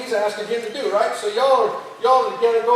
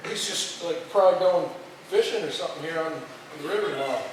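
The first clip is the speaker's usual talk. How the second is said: ordinary talk, nothing marked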